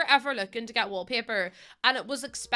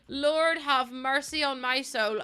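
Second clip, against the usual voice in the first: each word slow and drawn out, stress on Lord; slow, heavy Lord have mercy; slow plea; 2 semitones higher, slower than usual. Acted. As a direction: sigh it out like a weary prayer. Slow repeat of the line.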